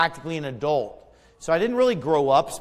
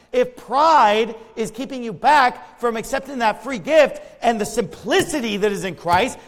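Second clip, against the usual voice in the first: ordinary talk, nothing marked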